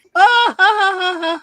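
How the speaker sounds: imitating her high burst of laughter; ha-ha-ha up high and loud. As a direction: girlfriend laughing as she runs out, high mock laugh. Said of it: "She ran out of the store laughing".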